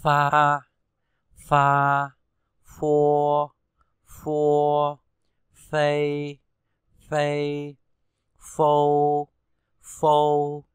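pha, phô read loud and clear, each one doubled; f syllables drill. Shown as a demonstration. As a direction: model the f sound like Vietnamese ph. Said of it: "Nhớ đọc như chữ phờ trong tiếng Việt". (đọc mẫu pha phô rõ, to, mỗi âm hai lần)

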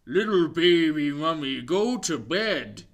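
a hint of a wobbly monster; lightly voiced blob monster. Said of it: "he said".

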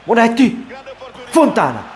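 sudden high shout of a name; urgent shout. Real, not acted. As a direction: yell the name at the screen. Shouting at player on chance.

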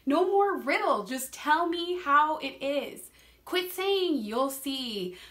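preacher acting out her own frustrated prayer, slow and insistent; exasperated prayer. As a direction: plead with God, spelling out each demand slowly. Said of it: "I've prayed the words".

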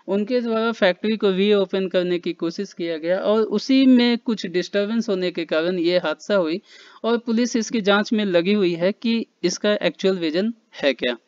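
ordinary delivery

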